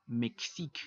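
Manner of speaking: "Mexique" said the French way, open E, ending in a crisp K; teacher modelling "Mexique" in French; French pronunciation demo. Shown as a demonstration. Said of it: "se va a pronunciar de forma abierta".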